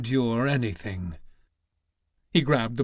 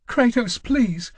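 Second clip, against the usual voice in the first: much higher, louder, pleading in fear; frightened cry; 11 semitones higher, 6 dB louder than usual. Acted. Wife lost in the temple pleading with Kratos, frightened cry. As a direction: cry out lost and scared, begging him. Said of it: "cried his wife".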